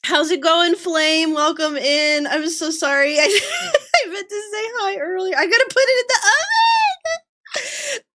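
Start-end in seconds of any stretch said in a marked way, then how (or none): 2.3-8.0 apologising through rising giggles; helpless laughter. Real, not acted. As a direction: let laughter push the words up into a squeal.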